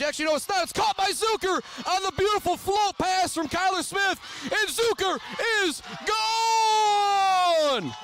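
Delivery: high shouted play-by-play, building to long drawn-out cries; real full-voice touchdown scream; ecstatic touchdown call. Real, not acted. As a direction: erupt as the play breaks open, stretching the last words.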